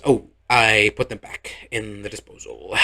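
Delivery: lightly voiced awkward Chet admitting it; sheepish groom. Chet's sheepish answer.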